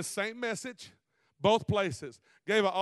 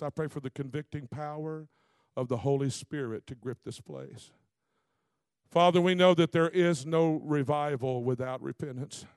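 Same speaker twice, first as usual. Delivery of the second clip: prayer; 3 semitones lower than usual. Acted. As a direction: plead with the Father, low and steady. Leads a prayer.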